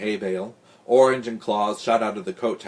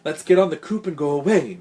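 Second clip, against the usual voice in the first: higher, louder urgent plea; shivering; 6 semitones higher, 5 dB louder than usual. A narrator's reading. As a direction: urge them to flee, mildly alarmed.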